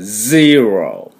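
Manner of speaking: ゼロ stretched very slowly as English zero. Shown as a demonstration. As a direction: draw out ゼロ with z and relaxed r. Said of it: "ゼットイーですから".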